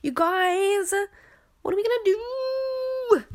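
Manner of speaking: squeaky, high panicked whine; high mock panic. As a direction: play it up, high and helpless.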